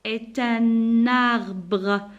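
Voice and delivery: "Est un arbre" very slowly as é-tun-narbre; syllable-by-syllable "Est un arbre" with both links sounded; liaison. Shown as a demonstration. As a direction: stretch "Est un arbre", N linked into arbre. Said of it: "la liaison entre le N et le A".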